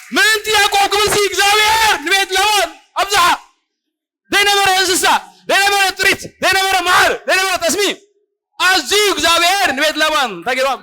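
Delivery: high, ringing full-force proclamation; impassioned preacher, pitched right up; fervent preaching shout. Real, not acted. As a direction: shout it out to rouse the congregation. Preacher shouts the repeated line, building list.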